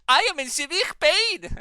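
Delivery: high, loud, slow mock German wail; hammed-up agony in a squeaky German voice; cartoon German sufferer. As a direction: cry out in exaggerated German-accented misery. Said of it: "It's like".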